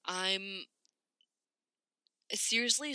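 ordinary talk

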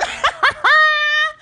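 high cackling laugh; real laugh. Real, not acted. Laughing at the three-hour podcast length, real laugh.

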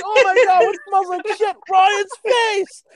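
high, loud laughing retell. Retold or imitated. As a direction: crack up while you say it. Retelling while cracking up.

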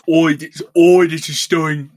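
loud slow cockney "oi, this is [name]"; mock [name] again loud. Podcaster bellowing a [name] impression, loud mock cockney. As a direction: bellow it like a cheeky London [name]. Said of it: "wish it was [name]".

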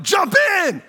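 high, loud mock shout; yelling. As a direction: bellow it from the bank. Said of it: "the whole time they're like".

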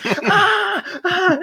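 high laughing yelps of pain; hammed-up high-pitched ah ah; mock pained cry. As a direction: yelp like a stabbed foot, half laughing. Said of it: "And I'm saying".

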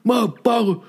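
high-pitched, strained mock screaming; imitated screaming. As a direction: rant like the obscenity-screaming stranger. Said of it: "he was screaming".

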